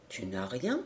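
very soft, low question rising at the end; hushed. Acted. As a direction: probe gently under the breath. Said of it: "me dit-il tout bas". (tout bas, grave et doux)

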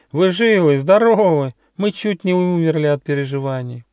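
high-pitched, overwrought sheep parents; bleating. A narrator's reading. Sheep parents find their daughters alive, bleating with relief. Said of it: "заблеяли они".

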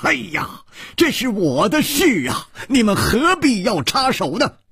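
high-pitched, frustrated protest; high, agitated complaint; exasperated. A narrator's reading. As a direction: protest as if stamping a foot. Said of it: "他跺着脚说".

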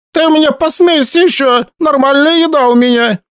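high, offended granny scolding; an old landlady huffing about her cooking; indignant old woman. A narrator's reading.

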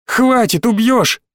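high-pitched panicked protest; alarmed plea. Acted. Boy begs a raging man to stop, alarmed. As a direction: cry out to stop the attacker.

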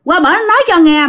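high, fast, pressing; husband hurrying his wife onto the motorbike, urgently. Retold or imitated. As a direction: rush her out the door. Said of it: "ổng gấp gáp dữ lắm".

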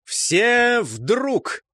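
high, drawn-out battle command; ringing double call. A narrator's reading. Gusli signal the squad to turn, ringing call. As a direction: let the order ring far. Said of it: "двойное звонкое отголосье".

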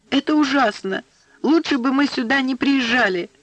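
high-pitched, upset outburst; upset girl exclaiming; distressed exclamation. A narrator's reading. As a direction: cry out in dismay, pitched well up. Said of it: "воскликнула Аннушка".

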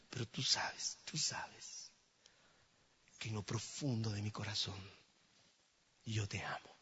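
hushed, whispered plea; soft confession. Acted. Peter confessing his love to the Lord softly.

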